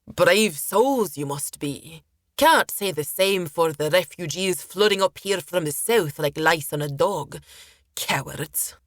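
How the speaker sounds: sailor's mocking praise turning to contempt; scornful sailor character. Sailor scorning the refugees from the south, contemptuous character voice.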